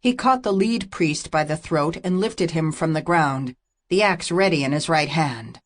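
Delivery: plain reading voice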